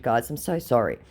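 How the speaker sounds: normal talking voice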